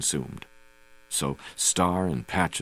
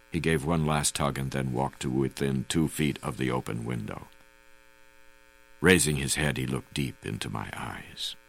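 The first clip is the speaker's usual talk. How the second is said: usual speaking voice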